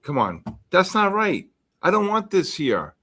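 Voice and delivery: pitched well up, fed-up protest; exasperated. Real, not acted. Streamer scolding chat over a fake death headline, exasperated. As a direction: push back at the chat, really irritated. Annoyed at offensive chat message.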